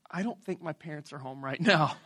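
low, soft, meek mock answer; sheepish reply. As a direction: answer the cop small and quiet. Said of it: "And I'm like".

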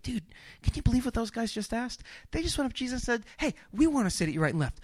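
quiet, quick gossiping aside; hushed disciple gossip. Acted. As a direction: whisper the news to the other guys.

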